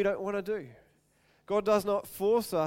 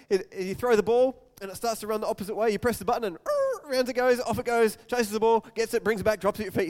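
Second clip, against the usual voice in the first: ordinary talk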